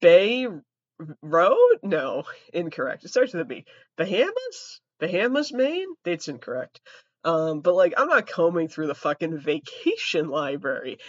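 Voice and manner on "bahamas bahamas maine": higher, questioning guesses; puzzled guessing. Real, not acted. Trying to recall the island name, high puzzled guesses. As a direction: grope for the name, each a doubtful question.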